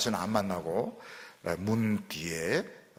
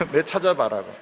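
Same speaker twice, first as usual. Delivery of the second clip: playful teasing; 3 semitones higher, 8 dB louder than usual. Retold or imitated.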